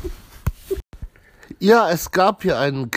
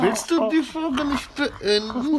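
menacing interrogator; 10 semitones higher than usual. Acted. Mock interrogator demanding answer.

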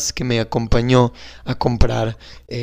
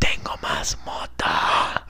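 slow breathy secret whisper in his ear; conspiratorial whisper to the nephew; 10 dB quieter, much slower than usual. Acted. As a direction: lean in and whisper it slowly. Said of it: "Te voy a susurrar algo".

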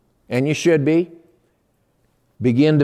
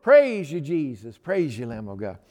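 rejoicing praise; 4 semitones higher than usual. Retold or imitated. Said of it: "our van is going".

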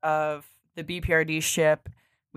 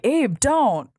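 higher-pitched plea to stop; retold urgent warning; 7 semitones higher than usual. Devin stopping Abe as he approaches, urgent warning. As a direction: act out a worried warning, voice raised in pitch.

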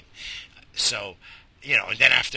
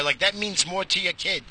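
normal talking voice

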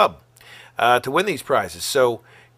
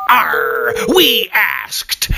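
pirate voice; 5 semitones higher, 5 dB louder, much slower than usual. Acted. Pirate exclamation in ad.

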